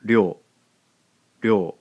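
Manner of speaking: ryo said as one glide; sound ryo. Shown as a demonstration. Teacher modelling the blended sound ryo. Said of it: "gives us the sound".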